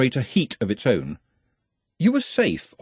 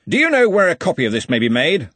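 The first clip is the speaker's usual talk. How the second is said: raised, higher commanding voice; loud, pitched-up demand; 7 semitones higher, 5 dB louder than usual. A narrator's reading. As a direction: ask it loudly, as if to the whole room. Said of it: "said Ridcully loudly".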